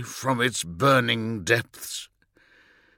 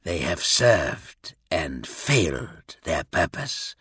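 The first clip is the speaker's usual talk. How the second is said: low, slow, deliberate pronouncement; character voice; 7 semitones lower, 3 dB quieter than usual. A narrator's reading. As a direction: drop low and weigh out each word. Kurz's voice within narrated story.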